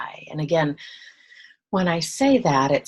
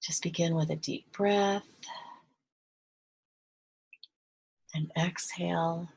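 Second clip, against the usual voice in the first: soft, hushed meditation voice with long pauses; guided meditation; 5 dB quieter than usual. Real, not acted. Guide leading listeners to relax and breathe, hushed meditation voice. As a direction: guide them gently into relaxation, softer and unhurried.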